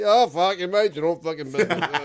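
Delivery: put-on loud, high slurred drunk yelling; drunk rant. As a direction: yell at the kid like a drunk. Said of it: "He's going".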